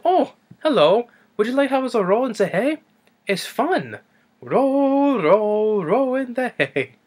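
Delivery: high, playful sing-song; imitated female assistant. Assistant inviting the doctor into the hay, high playful lilt. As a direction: lilt it high, stretching the rows. Voices Inga in the hay cart.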